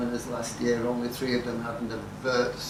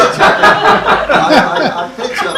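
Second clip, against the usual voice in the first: laughing; 9 semitones higher, 14 dB louder, much faster than usual. Real, not acted. Laughing start of story.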